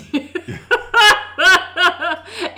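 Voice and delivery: high giggle; giggling. Real, not acted. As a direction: burst into giggles. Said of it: "Why are you laughing?".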